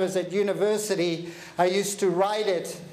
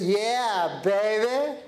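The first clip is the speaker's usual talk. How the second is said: put-on bright, bouncy "Yeah, baby" with a lift on "baby"; upbeat attitude; much slower than usual. Shown as a demonstration. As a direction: say "Yeah, baby" like you own the day. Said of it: "What's my attitude jacket like today?".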